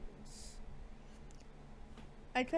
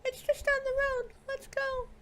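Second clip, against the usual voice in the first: much higher voiced game girl; a bright girl's voice pitched way up; character voice; 14 semitones higher, 6 dB louder than usual.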